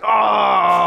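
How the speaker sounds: loud high gasp of surprise; shocked cry. Acted. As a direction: yelp in shock. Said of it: "he's like".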